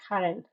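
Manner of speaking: Haren with a rasped throaty G start. Shown as a demonstration. Streamer sounding out a Spanish name, throaty G. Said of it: "It's like a G sound".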